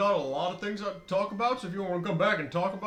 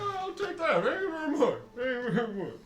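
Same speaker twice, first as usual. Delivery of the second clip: high villager voice; 6 semitones higher than usual. Acted. NPC takes the coin.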